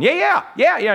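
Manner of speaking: put-on high, excited kid chorus yeah; eager kids. Kids begging Mom at the sample stand, high and eager. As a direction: beg like a kid who wants the sample.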